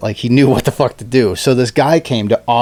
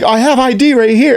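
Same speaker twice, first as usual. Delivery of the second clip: higher-pitched mock protest; agent protesting; 11 semitones higher than usual. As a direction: squeak out a flustered defense.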